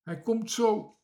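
high, loud blurting; urgent outburst. A narrator's reading. Bange Toontje reports seeing the lost sailor, blurting. Timid character blurts out news.

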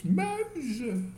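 high, loud cry of Muizen in alarm; frightened scream. A narrator's reading. Aunt Lena spots the mice in the basket, frightened scream. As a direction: yell Muizen as if one ran over your foot. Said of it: "schreeuwde tante Lena".

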